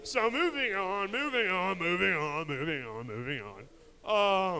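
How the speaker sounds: loud moving on, slowing at the end; loud repeated moving on. Acted. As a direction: hurry past the joke, then drag the last. Repeated to skip awkward joke.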